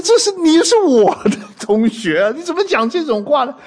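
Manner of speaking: high, comic outraged protest; retold indignation. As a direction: protest in mock outrage at a classmate. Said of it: "把我给气的呀". (又气又好笑地高声抗议)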